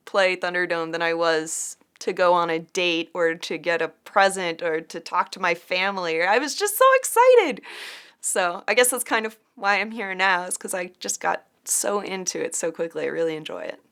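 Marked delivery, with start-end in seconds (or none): 6.2-7.6 high, bright burst of excitement; excited. Real, not acted. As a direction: gush about it. Excited recap of feeling.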